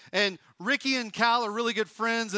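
usual speaking voice

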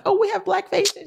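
very high, slow mimicking voice; mocking naive supporters. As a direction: send up naive delight in a squeaky-high pitch. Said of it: "'cause we were like".

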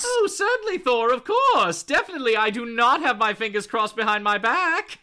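high, hammed-up insincere agreement; mock-innocent promise. Captive falsely promising her captor, hammed-up innocence.

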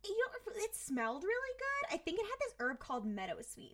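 coy, high-pitched opening that settles down. Retold or imitated. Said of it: "they're being coy, they're being whatever".

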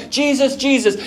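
high, loud repeated call; insistent shouting. Retold or imitated. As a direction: keep yelling to be heard over the crowd. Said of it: "He keeps shouting all the more".

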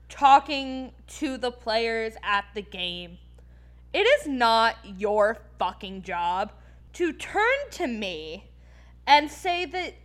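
slow, deliberate angry address; pointed scolding. Real, not acted. Woman addressing male hockey fans, slow angry scolding. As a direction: spell it out slowly, word by word.

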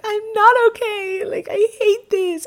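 high-pitched wailing, imitating herself in tears; hammed-up sobbing protest; mock crying. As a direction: whine it like a tearful meltdown. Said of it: "I'm crying so bad".

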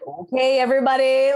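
playful club DJ shout-out. Shown as a demonstration. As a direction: throw on a hammed-up DJ voice. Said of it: "throwing on that D J voice".

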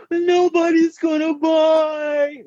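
drawn-out, high-pitched mock wail; panicked-client imitation. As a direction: wail it slowly, mimicking two doubting clients. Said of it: "like almost in unison".

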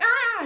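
high, loud re-enacted "ah"; short mock scream of shaky relief; high-pitched overwhelmed yelp; shaken cry. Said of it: "like, shaking".

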